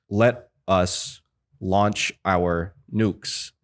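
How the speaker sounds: very slow, each word standing alone. Retold or imitated. Imitating the US ambassador's bad line delivery, word by word. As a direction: imitate a stilted actor, long gaps between words. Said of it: "word by word".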